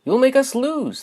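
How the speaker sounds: put-on squeaky jeering young dinosaur; taunting bullies. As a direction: sneer it like a schoolyard bully. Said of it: "they would say".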